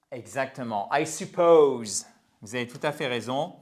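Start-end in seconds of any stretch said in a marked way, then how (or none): 0.9-2.1 slow, louder "suppose" with long stressed second syllable; pronunciation model. Shown as a demonstration. Teacher revealing how it is pronounced, long POSE in "suppose". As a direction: stretch POSE in "suppose", loud and clear. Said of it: "comment on prononce supposer?".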